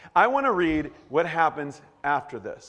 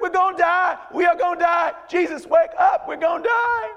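put-on high, shouted country-accent panic; panicked country folk; 13 semitones higher, 5 dB louder than usual. Preacher mimicking freaked-out disciples in the boat, country-accented panic. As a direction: holler it in a hammed-up country twang. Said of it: "They talked like that 'cause they were from the Galilee. They were country folk".

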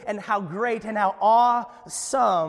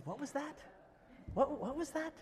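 soft, high-pitched murmured question; puzzled, straining to hear; 7 semitones higher, 12 dB quieter than usual. Acted. God leaning down to a tiny speck, soft puzzled question. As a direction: ask it very softly, pitch up.

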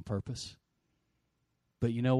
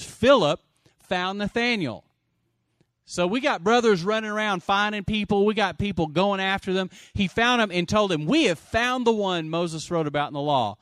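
raised, emphatic scripture preaching; forceful preaching; 7 semitones higher, 9 dB louder than usual. Real, not acted. Preacher declares Philip found Nathanael, forceful preaching. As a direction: proclaim it to the congregation.

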